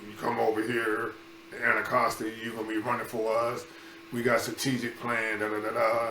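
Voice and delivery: coach voice. Retold or imitated. Big track coach pressing the boys to join, lightly voiced. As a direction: lean on the kids like a big recruiting coach.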